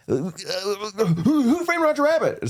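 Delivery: high, laughing panicked blurt; flustered blurt. Retold or imitated. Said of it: "I was like".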